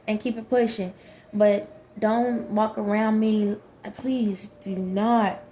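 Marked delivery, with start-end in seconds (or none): none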